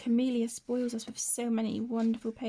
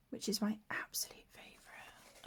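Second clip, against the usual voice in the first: whisper; 8 dB quieter than usual. Real, not acted. Softly showing favourite bookmark.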